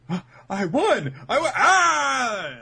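high, loud victory shout; triumphant cheer. Retold or imitated. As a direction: yell out the win like a kid. Cheering after winning shogi match.

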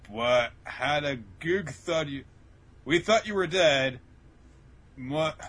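high, slow blubbered words; hammed-up tearful slurring; mock slurred crying. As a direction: imitate sobbing speech mangled into mush. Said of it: "he's slurring his words".

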